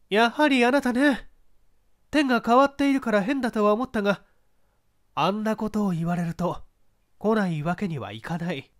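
high, spirited actress voice; proud woman facing her ex; strong-willed mezzo-soprano. Acted. Actress confronting her ex-husband, strong-willed and high. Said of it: "勝ち気らしいメソソプラノ".

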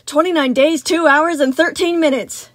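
loud, rapid-fire answer; immediate reply. A narrator's reading. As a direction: snap back the exact count. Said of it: "Sun replied immediately".

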